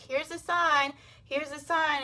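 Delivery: lightly voiced God, offering sign after sign; God's patient voice. God patiently offering signs to people, high playful voice.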